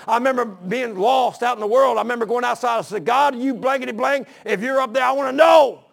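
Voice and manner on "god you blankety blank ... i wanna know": higher, building to a loud shout; retold challenge to God. Lost man challenging God outside, building to a shout. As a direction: cry out heavenward, louder on the last words.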